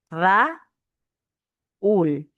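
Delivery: "Ra-, ul" with a long pause between Ra and ul; separated syllables. Shown as a demonstration. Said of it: "Separación y unión de vocales".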